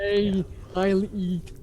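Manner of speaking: slow dumb ogre voice; lumbering dim brute, slowly; cartoon brute. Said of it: "Ogre voice".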